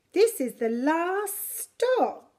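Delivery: plain reading voice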